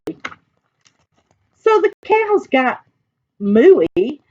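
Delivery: put-on high-pitched, lilting read to children; excited storytelling. A narrator's reading. Reader tells of animals joining in recess, excited. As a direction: lift each action with playful energy.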